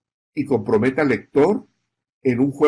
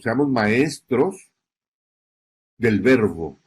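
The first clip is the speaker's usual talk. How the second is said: slow emphasis; slower than usual. Shown as a demonstration. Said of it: "Yo insisto mucho en eso".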